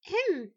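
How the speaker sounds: high, loud little cough; throat-clearing cough. A narrator's reading. Adversary coughing from afar to get attention, high cough. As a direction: clear your throat to break in. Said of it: "toussota l'adversaire".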